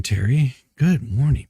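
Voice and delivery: normal talking voice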